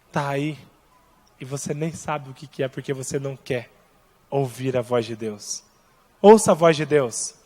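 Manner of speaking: ordinary talk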